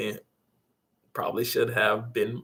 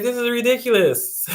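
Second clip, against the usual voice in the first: higher and louder, fed-up disbelief; exasperated scoff; 12 semitones higher, 7 dB louder than usual. Retold or imitated. To the friend asking for niceness, louder exasperated scoff. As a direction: throw it out, exasperated and loud.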